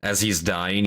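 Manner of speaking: usual speaking voice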